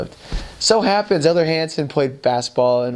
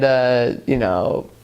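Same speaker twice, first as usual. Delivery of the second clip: normal talking voice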